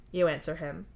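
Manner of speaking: plain reading voice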